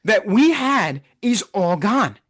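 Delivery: usual speaking voice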